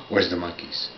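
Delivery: ordinary talk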